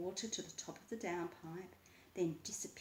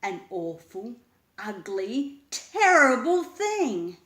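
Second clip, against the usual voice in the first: building frightened cry; 3 semitones higher, 17 dB louder, slower than usual. A narrator's reading. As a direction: panic rising to a wail. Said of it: "Master Puppet spooked".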